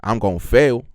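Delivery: confident put-on general American voice; American accent. Shown as a demonstration. Answering co-host's challenge to do an American accent, confidently. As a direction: push on boldly in an American accent. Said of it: "do an American a-, accent".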